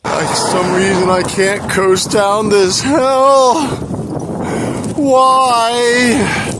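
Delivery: high, loud frustrated yelling into the wind; frustrated shout at the hill. Real, not acted. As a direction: yell it out, fed up with the hill.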